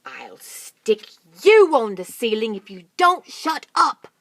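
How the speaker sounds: loud slow angry snap; snapping threat. Acted. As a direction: snap at the child. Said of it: "snapped Mugglewump".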